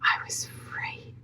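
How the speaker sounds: slow, barely voiced whisper; very soft, awed whisper; overcome whisper. Real, not acted. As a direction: whisper it slowly, choked with emotion. Overcome by her own testimony.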